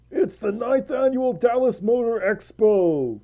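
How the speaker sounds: imitating a King of the Hill announcer; King of the Hill quote. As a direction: quote the show in its character voice. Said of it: "Now I'm quoting King of the Hill".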